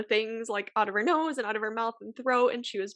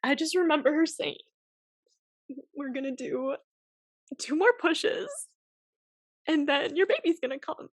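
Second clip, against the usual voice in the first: fighting tears while telling it; getting emotional; 8 semitones higher than usual. Real, not acted. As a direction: voice rising high and tearful, holding back tears. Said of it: "this is where I'm gonna get emotional".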